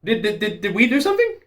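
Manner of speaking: high, rising disbelieving questions; stammering excited questions; excited disbelief. Real, not acted. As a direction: pitch up high in eager surprise. Reacting to British involvement.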